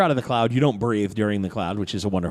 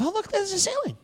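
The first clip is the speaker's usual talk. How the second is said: high, softened woozy musing; dazed thoughts; 3 dB quieter than usual. Acted. Game master voices poisoned Titus's thoughts, high and dazed. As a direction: think aloud in a daze. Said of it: "you think to yourself".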